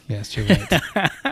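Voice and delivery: laughing. Real, not acted. Laughs while accepting praise.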